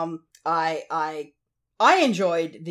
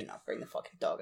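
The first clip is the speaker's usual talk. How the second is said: hushed urgent whisper; 13 dB quieter, faster than usual. Pretend. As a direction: whisper it like a secret plea. Joking plea about the dog.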